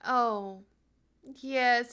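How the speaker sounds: usual speaking voice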